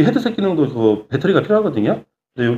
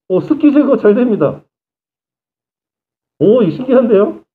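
excited; 7 semitones higher than usual. Real, not acted. Demister works inside mask.

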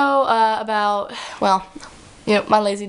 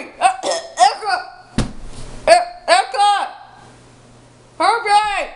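calling out; 7 semitones higher than usual. Acted. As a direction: shout across the house. Dad calling daughter from couch.

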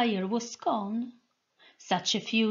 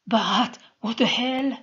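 fearful shout; 3 semitones higher, 5 dB louder than usual. A narrator's reading. Soldier shouting in fear at Sol. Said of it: "shouted the man in fear and resentment".

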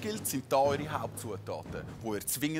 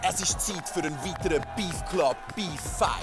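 boxing ring announcer; 5 semitones higher than usual. Acted. Host introduces fighters in ring.

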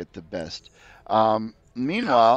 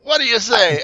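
high pleading creature; 11 semitones higher, 6 dB louder than usual. Acted. Creature asks.

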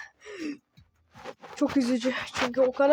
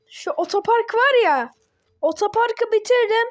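high, aggrieved griping; exasperated complaint; 7 semitones higher than usual. Real, not acted. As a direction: lift the voice high in frustration. Complaining about finished car park level.

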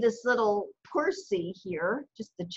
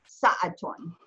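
normal talking voice

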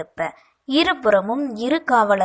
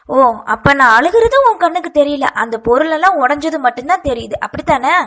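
higher and louder, hurt and accusing; angry retort; 4 semitones higher, 6 dB louder, faster than usual. A narrator's reading. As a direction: fire it back at her brother. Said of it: "கோபத்துடன் கேட்டாள்".

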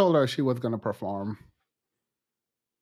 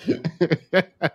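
laughing. Real, not acted. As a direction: break into helpless laughter mid-sentence. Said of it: "I don't know why this is funny".

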